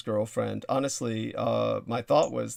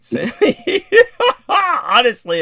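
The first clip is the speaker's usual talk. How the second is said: high, loud laugh; 12 semitones higher, 12 dB louder, slower than usual. Real, not acted. Greets caller, bursts out laughing.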